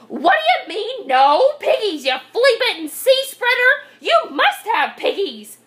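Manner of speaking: high, loud outraged tirade; exasperated screechy witch; enraged witch. A narrator's reading. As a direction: explode at the farmer as a storybook witch. Witch rages at the farmer.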